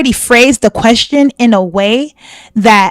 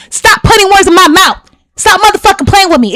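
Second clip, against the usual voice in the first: raised, heated retelling of her own outburst; retold angry demand; 8 semitones higher than usual. Woman retelling her heated demand to the podcast host, angrily. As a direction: snap it back like you did before walking out. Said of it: "what was I saying?".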